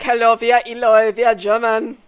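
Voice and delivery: mock German introduction; German accent. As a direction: imitate a German introducing his band. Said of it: "German be like".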